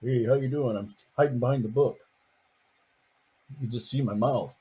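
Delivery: plain reading voice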